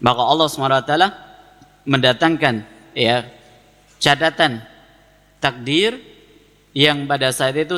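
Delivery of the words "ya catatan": normal talking voice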